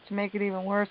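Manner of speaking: normal talking voice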